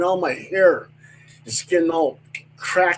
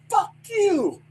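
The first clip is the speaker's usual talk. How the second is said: high-pitched defiant yell back; defiant retort. Retold or imitated. [name] refusing the captain from the truck, high defiant yell. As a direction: refuse him flat out, high. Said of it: "I go".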